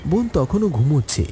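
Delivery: ordinary delivery, nothing marked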